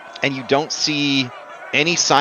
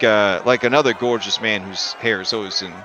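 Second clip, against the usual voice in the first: ordinary talk, nothing marked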